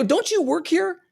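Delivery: very high-pitched, pointed question; retold incredulous challenge. Gym-goer confronting a woman who works there, high incredulous challenge. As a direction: challenge them with pitch shooting right up.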